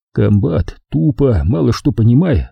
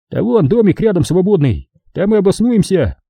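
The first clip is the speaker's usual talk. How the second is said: higher, easygoing soldier; soldier comrade voice; 7 semitones higher than usual. Acted. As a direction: point out the empty house. Comrade answers.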